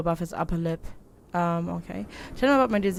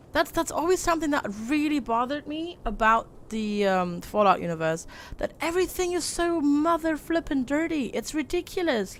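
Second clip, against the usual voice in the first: higher, worked-up rant about the mess; excited rant; 7 semitones higher than usual. Real, not acted. Streamer ranting to chat about the filthy Fallout world, excited. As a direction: get a bit fired up.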